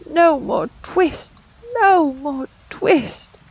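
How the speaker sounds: high, slow delirious moan; feverish mumbling. Acted. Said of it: "he mumbled".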